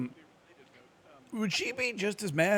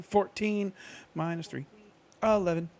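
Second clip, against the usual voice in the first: louder, higher reveal; announcing result; 6 semitones higher, 4 dB louder than usual. Real, not acted. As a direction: blurt out the number.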